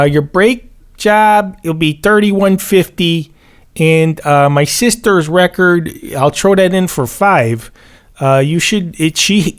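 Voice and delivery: put-on a tire-shop guy with a Chicago accent makes a pitch; mock Chicago mechanic. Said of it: "the mechanic has ... a Chicago accent".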